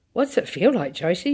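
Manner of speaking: plain reading voice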